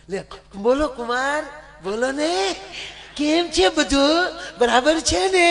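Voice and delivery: high, eager mother-in-law greeting. Acted. Mother-in-law takes son-in-law's call. (ઊંચા અવાજે હરખાતી સાસુ)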